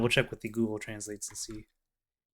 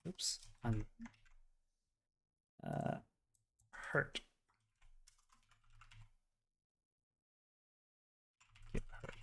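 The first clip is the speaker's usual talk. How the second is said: muttered aside; 2 semitones higher, 18 dB quieter, slower than usual. Real, not acted. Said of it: "quiet mumble near mic".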